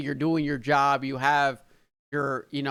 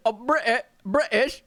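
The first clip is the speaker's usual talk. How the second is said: high-pitched mock British accent; terrible British accent; 6 semitones higher than usual. Shown as a demonstration. American trying one word after laughing, bad British accent. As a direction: badly attempt British, pitched up. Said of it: "My English accent is terrible".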